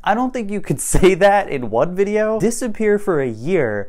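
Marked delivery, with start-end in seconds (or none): none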